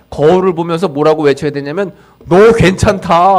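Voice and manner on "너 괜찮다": loud, slow, emphatic call; a firm encouraging shout; shouted affirmation. Shown as a demonstration. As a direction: proclaim it to yourself in the mirror. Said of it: "하고 외쳐야 돼요".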